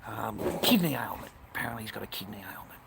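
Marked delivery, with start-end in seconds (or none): 1.5-2.8 fast hushed whisper; a rushed whisper about bad news. Real, not acted. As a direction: drop to a quick whisper. Whispered aside about sick friend.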